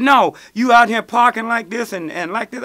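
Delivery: mock angry officer; officer scolding. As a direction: bark it like a cop catching you. Police officer scolding him.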